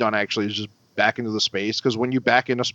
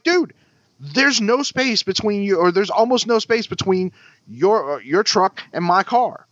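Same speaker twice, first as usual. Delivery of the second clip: higher-pitched retold protest; exasperated insistence; 8 semitones higher than usual. Driver explaining the lack of space, exasperated. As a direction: spell out the obvious, annoyed.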